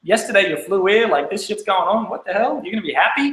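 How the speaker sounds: mock-incredulous uncle, rising questions. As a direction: scoff at the idea, half laughing. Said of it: "he's like".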